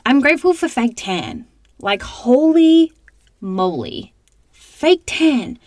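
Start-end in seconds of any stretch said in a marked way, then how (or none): 1.8-4.1 excited gush. Real, not acted. Raving about fake tan to listeners, excited. 4.5-5.6 much higher, louder fake tan; thrilled exclaim. Real, not acted. Raving about fake tan to listeners, excited squeal.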